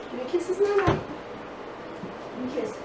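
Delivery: slow whispered tender ask; soft bedtime voice. Real, not acted. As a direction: coax softly for a kiss. Bedtime kisses.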